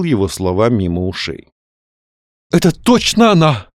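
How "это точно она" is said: high, emphatic certainty; convinced. A narrator's reading. Young man insists he saw Каролина, convinced. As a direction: declare it with rapt conviction. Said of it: "проговорил он убеждённо".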